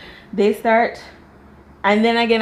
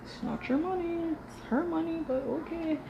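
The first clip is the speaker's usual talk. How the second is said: high, much softer knowing remark; sarcastic soft aside; 9 semitones higher, 10 dB quieter than usual. Retold or imitated. Telling the husband it isn't his money, hushed and sarcastic. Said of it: "and I was like".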